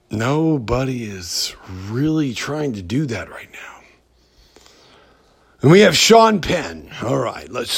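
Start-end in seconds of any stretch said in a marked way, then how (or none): 0.1-3.7 whispered aside. Real, not acted. Podcaster whispering a side remark to listeners. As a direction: drop to a whisper to share it.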